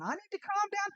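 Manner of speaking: high, incredulous echo; can't believe they said it; incredulous echo, pitched high; indignant echo. Retold or imitated.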